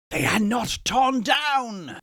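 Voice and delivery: high, slow, anguished cry; cried out. A narrator's reading. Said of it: "cried Scrooge".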